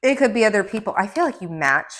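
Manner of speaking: usual speaking voice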